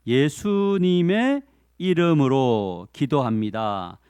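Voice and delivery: slow prayer ending; prayer closing. Shown as a demonstration. Preacher showing students how prayers end, slow. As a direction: close a prayer slowly and reverently. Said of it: "마지막 기도하죠".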